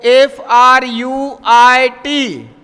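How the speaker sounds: slow, letter by letter; slow spelling for learners. Shown as a demonstration. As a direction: spell each letter out clearly. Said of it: "spelling out letters slowly".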